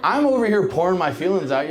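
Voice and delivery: usual speaking voice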